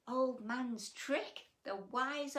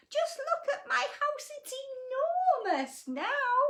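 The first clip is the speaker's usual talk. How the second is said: put-on high, laughing old lady; thrilled with the space; delighted old lady; 4 dB louder than usual. A narrator's reading. Old lady delighted her house feels big, high and laughing.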